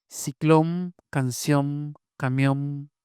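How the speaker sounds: "Ciclón, canción, camión" ending on closed-lip m; final N as M. Shown as a demonstration. Linguist listing examples of final N, said as M. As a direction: close the lips on the last sound of "camión". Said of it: "Realización bilabial de M, de N final".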